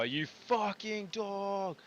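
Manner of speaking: long, slow, high furious groan; angry. Real, not acted. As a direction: drag out the insult in rage. Raging at game enemy.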